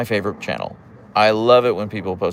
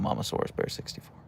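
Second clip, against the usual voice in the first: whispered repeat; 10 dB quieter than usual. Real, not acted. Repeating the viewer name.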